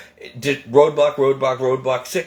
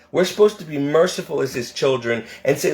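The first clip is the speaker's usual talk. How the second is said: ordinary talk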